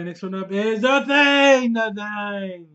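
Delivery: loud, slow hyped title call; excited announcement. Real, not acted. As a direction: announce it like a trailer. Announcing the next film.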